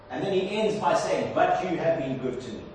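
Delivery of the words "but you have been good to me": lower, settled voice after the outcry; soft-edged, low resolution of a lament; quiet trust. Acted. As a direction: come down to calm assurance. Psalm's trusting ending after the cry.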